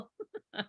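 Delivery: squealing high laugh. Real, not acted. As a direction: let out a high giggle. Laughs after funny reading.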